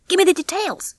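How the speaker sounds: high-pitched, keen demand; eager Rocky. A narrator's reading. Rocky wants the heist details from the rats, eager. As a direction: press them for the story.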